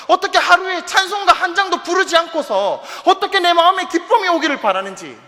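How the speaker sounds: high, driving preacher's challenge; preaching at full force. Real, not acted. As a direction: pile up urgent rhetorical questions. (높은 목소리로 다그치는 설교)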